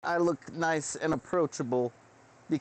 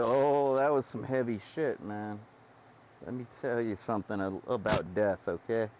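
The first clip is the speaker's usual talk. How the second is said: weary doctor; 6 semitones lower than usual. Retold or imitated. Doctor telling the speaker about his first patient, low weary voice. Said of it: "they'll tell you".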